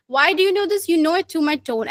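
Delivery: ordinary talk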